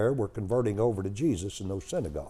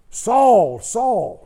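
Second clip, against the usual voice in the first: very high, very loud shout of a name; God hollering; 12 semitones higher, 12 dB louder than usual. Acted. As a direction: holler it from a blinding light. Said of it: "Just hollered at you".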